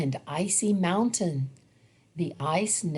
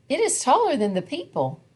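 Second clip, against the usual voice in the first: amazed; 7 semitones higher, much faster than usual. A narrator's reading. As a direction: marvel at its size. Plant grows huge.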